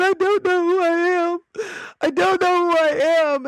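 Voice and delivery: high hammed-up wail; mock crying. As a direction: imitate a sobbing twelve-year-old in crisis. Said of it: "went in and just cried".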